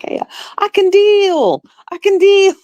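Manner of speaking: relieved cheer. Retold or imitated. Relieved reaction to her arm.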